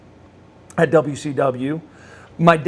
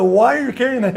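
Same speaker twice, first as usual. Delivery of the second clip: higher pitched puzzled ask; surprised question; 7 semitones higher, 3 dB louder than usual. Retold or imitated. Asking a friend about the wallet photo, surprised. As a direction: ask it as if caught off guard. Finds his picture in wallet.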